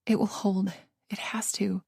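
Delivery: unvoiced, hushed self-assurance; fearful whisper. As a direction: whisper it like a prayer.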